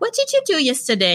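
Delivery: mock-sweet, overly friendly greeting; super sweet boss. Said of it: "super sweet".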